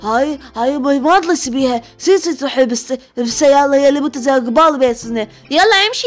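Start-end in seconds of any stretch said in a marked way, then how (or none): none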